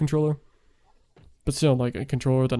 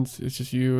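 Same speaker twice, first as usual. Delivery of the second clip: ordinary talk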